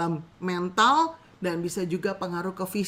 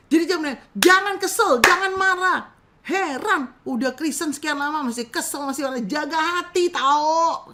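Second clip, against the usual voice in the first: loud, higher preachy reproach; scolding counselor; 6 semitones higher, 6 dB louder than usual. Acted. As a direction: lecture the client like a stern church elder. Mock bad counselor scolding client.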